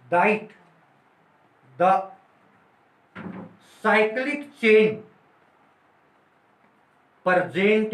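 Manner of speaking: word by word, very slow, pausing for notes; slow dictation. Shown as a demonstration. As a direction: dictate so students can write it down. Teacher dictating notes slowly. (धीमे-धीमे लिखवाते हुए)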